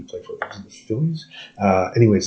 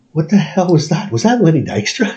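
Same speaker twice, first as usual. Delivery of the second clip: higher, stunned question; shocked disbelief; 7 semitones higher than usual. Retold or imitated. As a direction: ask it like you can't believe your eyes. Retold shocked question to host.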